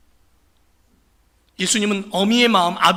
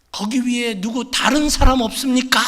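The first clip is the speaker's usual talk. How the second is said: desperate shout; 4 semitones higher, 4 dB louder than usual. Acted. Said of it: "소리, 소리를 지릅니다".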